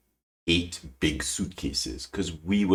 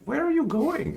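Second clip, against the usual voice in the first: high curious voice. Retold or imitated. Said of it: "the taxi driver was like".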